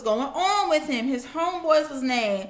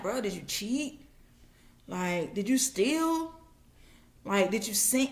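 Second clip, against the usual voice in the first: quietly grilling a friend about his secrets; hushed questioning; 3 semitones lower, 7 dB quieter than usual. Acted. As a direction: lean in and ask in a hush. Friends interrogating Job.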